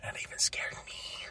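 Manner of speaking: whispered aside; hushed confessing whisper. Real, not acted. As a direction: whisper it like a secret.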